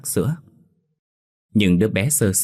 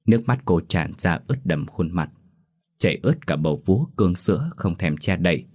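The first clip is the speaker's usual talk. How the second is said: usual speaking voice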